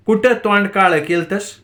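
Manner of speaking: snapping. Acted. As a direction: snap at him, half in earnest. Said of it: "ताराबाईने खेकसत विचारलं".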